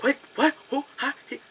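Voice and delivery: high, choppy string of baffled questions; confused Mo. Retold or imitated. Imitating Mo lost throughout the movie, baffled. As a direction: mimic someone who is totally lost. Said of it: "Mo is like".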